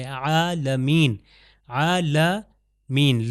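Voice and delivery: عالمین drawn out very slowly, ع from mid throat; careful recitation. Shown as a demonstration. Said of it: "ہا اور عین کی ایک ہی جگہ سے".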